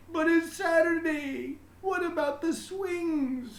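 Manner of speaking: put-on high, loud child's voice calling; a kid brother protesting from the doorway; little brother calling out. A narrator's reading. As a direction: call out like an upset little boy. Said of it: "her brother called".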